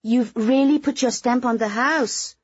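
high, drawn-out society gush; lavish slow praise on the hostess; gushing compliment. A narrator's reading. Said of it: "gushing about the radical transformation".

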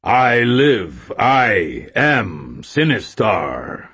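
slow, loud booming game villain; Sinistar game voice. A narrator's reading. Arcade game villain speaks, slow booming voice. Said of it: "said the voice on the Sinistar game".

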